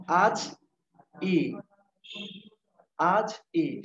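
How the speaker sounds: আজ and ই split apart, slowly; slow motion. Shown as a demonstration. Teacher pronouncing আজি in slow motion for the class. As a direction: pull আজ and ই apart, syllable by syllable. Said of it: "slow motion উচ্চারণ করো".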